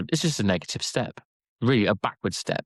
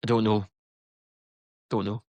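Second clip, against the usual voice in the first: put-on Scottish accent; offhand, in a Scottish accent. Shown as a demonstration. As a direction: answer in a Scots accent. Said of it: "He's such a good accent".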